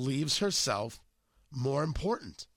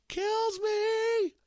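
very high, drawn-out falsetto. Shown as a demonstration. Host with a cold proving he can still hit the falsetto. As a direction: leap right up into a sustained falsetto. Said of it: "I can hit the falsetto".

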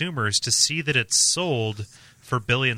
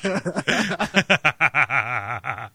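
laughing; 3 semitones higher than usual. Real, not acted. Laughing at joke.

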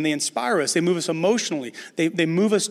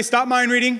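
called out loud; 6 semitones higher, 3 dB louder than usual. Shown as a demonstration. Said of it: "Somebody say".

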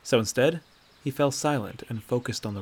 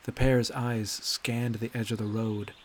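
usual speaking voice

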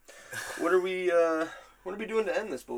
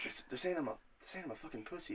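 weak Mister T; 2 semitones higher, 13 dB quieter, faster than usual. Retold or imitated. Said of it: "I can't give him the same voice as Mister T".

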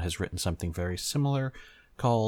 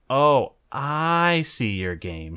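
slow, louder drawn-out tease; teasing; 7 semitones higher, 8 dB louder, much slower than usual. Acted. Miyako to Yamato, slow loud teasing drawl. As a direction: savour each word like a tease. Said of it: "she teased".

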